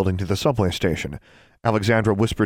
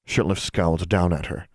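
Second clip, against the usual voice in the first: plain reading voice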